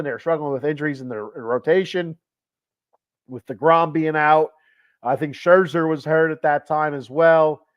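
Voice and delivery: ordinary talk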